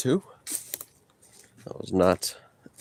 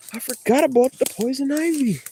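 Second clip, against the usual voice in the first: sudden high-pitched dismay; high squeak of alarm at forgetting; alarmed realization. Real, not acted. Realizes poison ivy danger.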